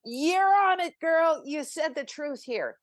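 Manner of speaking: pumped-up self-encouragement; cheering myself on. Retold or imitated. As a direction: hype yourself up like a best friend. Inner voice cheering her on.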